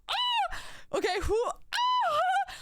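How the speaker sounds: very high sudden shriek, real fright; real startled scream, very high. Real, not acted. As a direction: shriek out in a jolt of alarm.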